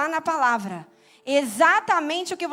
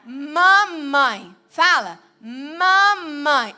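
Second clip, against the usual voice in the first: put-on loud, drawn-out baby-teaching voice; imitating a parent coaxing first words; parent teaching speech; 6 dB louder, much slower than usual. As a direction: stretch each syllable loudly for a baby. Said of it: "no início é assim".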